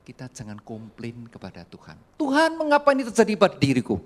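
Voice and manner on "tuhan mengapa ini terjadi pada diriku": mock complaint. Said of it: "Bukan itu perkataan kita".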